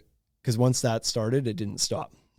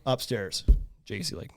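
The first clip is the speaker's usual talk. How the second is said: ordinary talk, nothing marked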